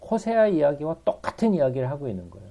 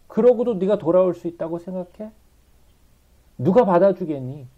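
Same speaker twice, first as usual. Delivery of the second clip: reproachful; 5 semitones higher, 6 dB louder than usual. Acted. Voices God reproaching people.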